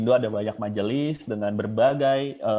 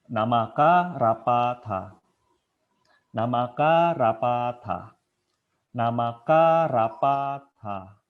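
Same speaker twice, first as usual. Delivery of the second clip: Namaka rapata drawn out slowly, syllable by syllable; slow chanted Pali drill; slow clear syllables; 4 dB louder, slower than usual. Shown as a demonstration. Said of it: "kita harus clear ngucapinnya".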